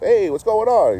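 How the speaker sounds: high, loud happy hello to a known face; bright friendly greeting. Retold or imitated. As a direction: greet an old regular warmly. Face lights up, greeting someone.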